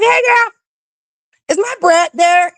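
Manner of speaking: cheery greeting. Retold or imitated. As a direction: sing it out like a friendly "hey girl". Mom calls friend's mom.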